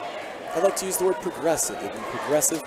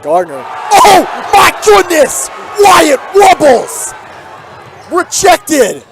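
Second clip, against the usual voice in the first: much higher and louder, yelling the block; excited shout; 13 semitones higher, 13 dB louder, slower than usual. Real, not acted. Commentator calling the big block to the crowd, thrilled shout. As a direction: roar it like the crowd just exploded.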